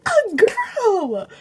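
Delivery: put-on it's a girl, building shocked repeats; shocked disbelief. Shocked retelling of discovery.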